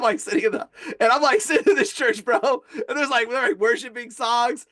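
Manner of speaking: ordinary delivery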